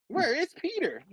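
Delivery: high imitated worry; worried plea. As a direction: ask it in a put-on high voice, each word apart. Repeats it drawn out, softer.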